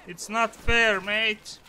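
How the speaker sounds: high, loud complaint; protest. Real, not acted. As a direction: cry out at the unfairness. Complaining after dying in game.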